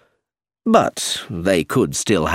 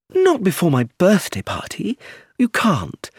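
put-on higher, spoilt young woman insisting; Rosemary pleading; 10 semitones higher than usual. A narrator's reading. Rosemary begs Anthony to stay for her party, higher spoilt pleading. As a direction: wheedle him like a spoilt girl, pitched up.

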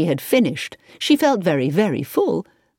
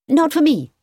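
high, quick refusal; 10 semitones higher, 4 dB louder than usual. A narrator's reading. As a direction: turn it down promptly in a high voice. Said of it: "said Victoria quickly".

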